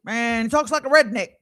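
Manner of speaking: ordinary talk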